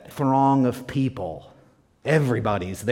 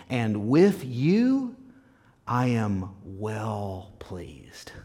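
solemn divine declaration; much slower than usual. Acted. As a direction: let each word stand alone, sinking to a hush at the end. Voice of God, solemn slow close.

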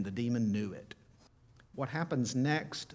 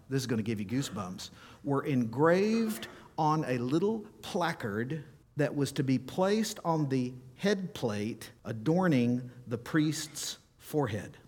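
ordinary delivery

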